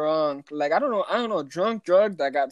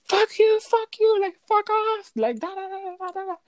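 very high, yelled re-enactment; acted screaming match; 14 semitones higher than usual. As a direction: hurl it as in a retold shouting match. Acted screaming back-and-forth argument.

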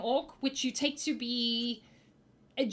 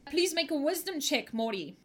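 ordinary talk, nothing marked

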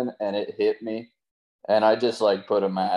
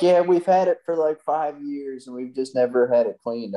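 quoting the customer; 5 semitones higher than usual. Retold or imitated. Imitating customer lady.

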